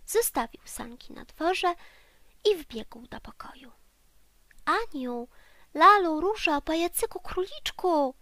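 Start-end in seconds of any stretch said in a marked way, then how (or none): 4.6-8.1 loud, high calls to his friends; calling from the doorway. Acted. Teddy bear calls to his friends from the doorway, loud and high. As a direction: call each name out across the room. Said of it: "wołał od progu".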